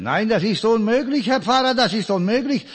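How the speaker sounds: high, loud insistence of a landlady. Retold or imitated. Landlady insisting to the old pastor, high and loud. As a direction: protest firmly as the innkeeper.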